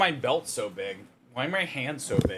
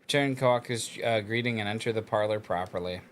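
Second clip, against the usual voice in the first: story narration. Acted. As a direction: read it like a novel narrator. Reads visual novel text aloud.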